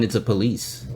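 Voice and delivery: ordinary talk, nothing marked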